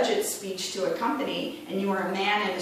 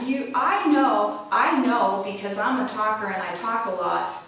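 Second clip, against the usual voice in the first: ordinary talk, nothing marked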